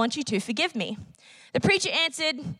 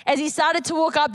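normal talking voice

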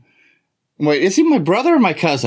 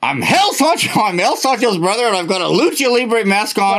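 higher-pitched self-introduction to the crowd; announcing to listeners; 3 semitones higher than usual. Pretend. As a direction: proclaim it to the listening audience. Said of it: "I need to tell our listening crowd".

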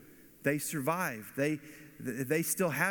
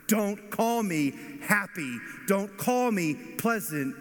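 higher, louder, slow, bitter outcry; pained outcry; 5 semitones higher, 4 dB louder, slower than usual. Acted. Naomi refusing to be called pleasant, raised pained outcry. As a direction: fling it back at them in pain.

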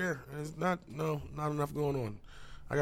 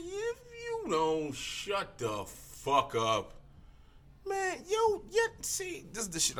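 high mock rant; slower than usual. Ranting at Meghan Markle.

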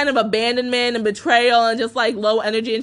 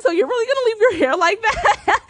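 laughing taunt; 8 semitones higher than usual. Retold or imitated. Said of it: "she was just like".

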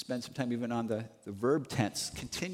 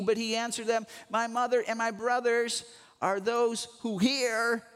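lightly raised preaching voice reading scripture; fervent sermon reading; 7 semitones higher than usual. Acted. Preacher reading, then pressing the point.

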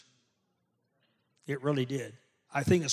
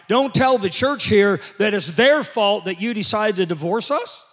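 loud, high preaching at full force; preaching hard; 6 semitones higher, 8 dB louder than usual. Real, not acted. Pastor preaching hard to the church about people who leave, loud and high. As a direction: bring the sermon to a roaring peak. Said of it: "I'm preaching really good this morning".

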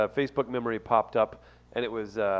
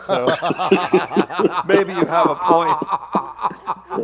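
slow, higher, louder, through laughter; laughing; 4 semitones higher, 7 dB louder, slower than usual. Real, not acted. Host concedes the friend's point, laughing hard. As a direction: concede the point while cracking up. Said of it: "[name], it ain't that funny".

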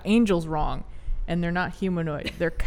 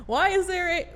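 mock olde-time voice, pitched up; hammy stage actor; 12 semitones higher than usual. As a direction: ham up an old-fashioned speech. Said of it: "oh, it would, old time".

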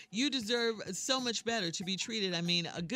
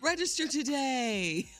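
slow, louder promo push; promo announcer; 5 semitones higher, 4 dB louder than usual. Acted. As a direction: sell it like a radio ad.

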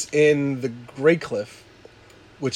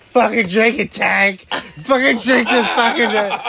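higher, louder pushy voice as Donna; bossy friend; 6 semitones higher, 3 dB louder than usual. Retold or imitated. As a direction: push him to drink. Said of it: "She's like".

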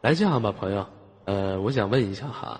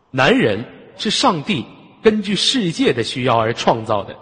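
dramatic declamation; 11 semitones higher, 8 dB louder than usual. Acted. Host declaims his big aphorism.

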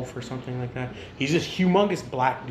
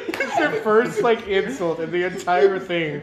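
laughing; 5 semitones higher, 7 dB louder, slower than usual. Real, not acted. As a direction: still laughing while explaining. Still laughing after the joke.

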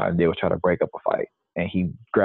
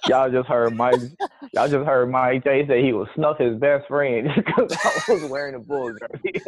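laughing; 7 semitones higher than usual. Real, not acted. Teasing friend, laughing at the jab.

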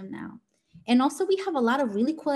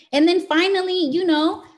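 excited; 8 semitones higher, 7 dB louder than usual. Real, not acted. Emphatic turn to last point.

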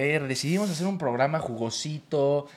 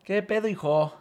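put-on Chilango accent; 8 semitones higher, 4 dB louder than usual. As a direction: put on a thick Chilango street accent, high and bright. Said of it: "saca tu acento chilango".

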